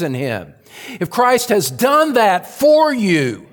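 swelling, urgent sermon climax; impassioned preaching. Real, not acted. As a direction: preach it with mounting force. Preaches at rising force.

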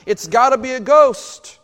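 a touch of mock panic; panicked. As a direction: blurt it out in alarm. Voicing disciples' panic.

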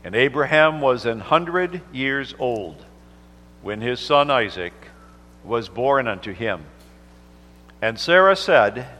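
measured, solemn Bible reading; unhurried King James reading; scripture reading. Acted. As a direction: read the holy word from the pulpit. Minister reads scripture aloud.

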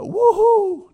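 a big, high, loud whoop of joy; mock cheer. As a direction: cheer like a fan at a game. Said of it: "they don't go".

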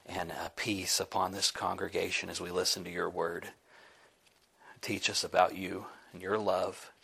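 soft, hushed prayer voice; humble whispered-down prayer. Real, not acted. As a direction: pray quietly to God before the sermon. Opens with a prayer.

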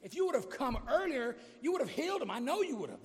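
loud, high-pitched reproach to Jesus; frustrated. Acted. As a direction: plead in acted frustration, voice pushed up. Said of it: "experience their frustration towards him".

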